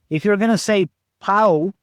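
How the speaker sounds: ordinary talk, nothing marked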